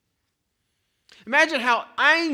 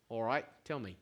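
laid-back Aussie; 7 semitones lower, 10 dB quieter than usual. Acted. Wayne answers in acted dialogue.

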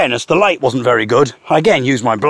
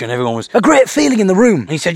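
usual speaking voice

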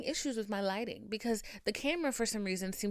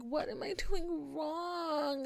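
mock exasperated wail; 8 semitones higher, much slower than usual. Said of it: "So I'm like".

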